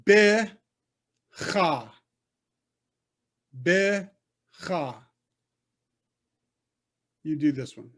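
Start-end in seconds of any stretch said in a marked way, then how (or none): 0.0-1.9 "Be-cha" with a long gap between syllables; teacher modelling "Be ... cha"; syllable-by-syllable demo. Shown as a demonstration. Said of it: "I'm doing it". 3.6-5.0 "Be-cha" split into two beats; teacher repeating "Be ... cha" for learners; syllable-by-syllable demo. Shown as a demonstration. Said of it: "I'm doing it".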